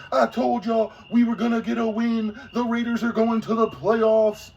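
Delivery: high mock brag of a coworker who is a Raiders fan; gloating Raiders fan. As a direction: ham up a smug fan crowing about his team. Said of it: "he's gonna be like".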